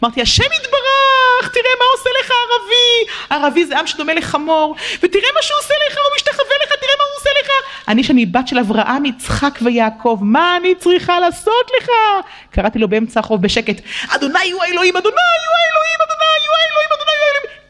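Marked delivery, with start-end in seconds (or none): 0.2-7.5 moved outcry. Retold or imitated. Speaker crying out to God, high and moved. 15.0-17.6 very high, full-voiced cry of אדוני הוא האלוהים; with all my strength. Speaker crying out to God on the street with all her strength. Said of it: "בכל הכוחות".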